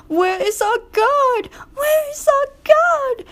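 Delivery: squeaky high wailing carriages; crying coaches. A narrator's reading. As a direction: cry out for the lost guard. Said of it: "they cried".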